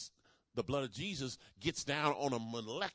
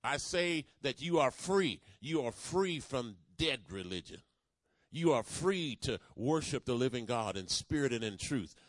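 full-force preaching; 6 dB louder than usual. Acted.

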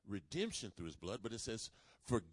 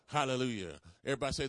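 ordinary talk, nothing marked

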